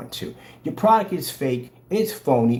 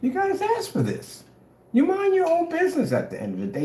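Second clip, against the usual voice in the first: mock high voice. Mock voice to critic.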